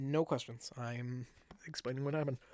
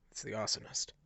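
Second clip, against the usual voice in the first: unvoiced hush to himself; whisper; 4 semitones lower, 7 dB quieter than usual. Mayor recognizing the arsonist, whispered.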